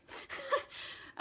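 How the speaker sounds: airy squeak of a laugh; high breathy laugh. Real, not acted. Streamer laughing at her own rough night, high breathy laugh. Ironic aside about tonight.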